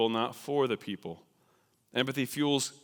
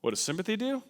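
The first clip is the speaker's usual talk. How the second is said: ordinary talk, nothing marked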